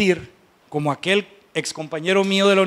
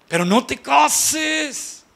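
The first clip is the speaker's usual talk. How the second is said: slow, higher, stretched-out plea; pleading advice; 5 semitones higher, much slower than usual. Acted. Advising a pregnant woman against marrying the lout, pleading. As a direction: implore her, dragging out the last word.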